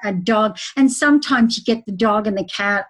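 ordinary talk, nothing marked

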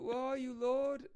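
high loud call across a distance. Acted. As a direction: shout it out, searching for him. Calling out for someone.

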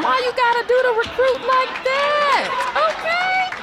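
high, loud, indignant squeal at the joke; mock outrage. Real, not acted. Host squealing in mock outrage at the joke's cruel punchline. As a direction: protest like the recruit got robbed.

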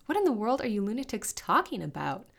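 loud, high-pitched bewilderment; exasperated disbelief; baffled question. A narrator's reading. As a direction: demand an explanation, incredulous. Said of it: "asked Serena".

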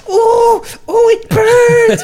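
very high, loud drawn-out mock cry; hammed-up howl of stinging pain; pained wail. Injured man rinsing his cut finger, pained wail.

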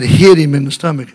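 loud, forceful order; emphatic command. Retold or imitated. God repeating his order to the preacher, loud command. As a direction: boom the order out, no argument.

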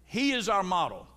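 ordinary delivery

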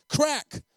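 loud, high voiced whip crack; belt crack. A narrator's reading. Preacher narrates the beating, loud sharp crack.

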